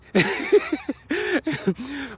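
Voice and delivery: high, drawn-out giggle; real laugh. Real, not acted. Runner amused by their own remark, high giggling laugh. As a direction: let a high laugh bubble out.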